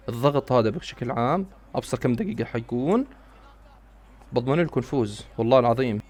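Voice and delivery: normal talking voice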